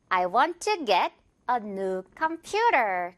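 very slow read, 퓨 of computer stressed; slowly, leaning on com-PU-ter; slow model sentence. Shown as a demonstration. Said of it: "퓨 부분에 강세 주면서". (아주 천천히, computer의 퓨에 강세)

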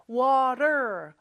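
North American "water", T as a quick flap; North American accent. Shown as a demonstration. Teacher shows the North American flapped T, American accent. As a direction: say "water" the American way, WAH-der with final R. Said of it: "입천장에 살짝 닿았다가 떨어지는 소리예요".

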